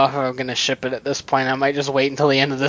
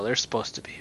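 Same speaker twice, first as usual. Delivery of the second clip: whispered aside; breathy secret aside; 5 semitones lower, 9 dB quieter, faster than usual. Real, not acted.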